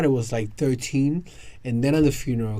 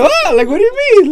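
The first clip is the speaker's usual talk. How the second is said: very high, loud disbelief; shocked outburst; 15 dB louder than usual. Retold or imitated. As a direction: shriek it in shock. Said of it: "I was like".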